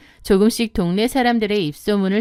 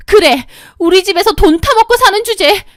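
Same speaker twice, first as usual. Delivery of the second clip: very high, loud sneering insult; contemptuous shriek; 10 semitones higher, 7 dB louder than usual. Acted. Sister-in-law mocking narrator for living off family, contemptuous. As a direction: sneer down at her.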